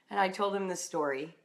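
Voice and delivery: normal talking voice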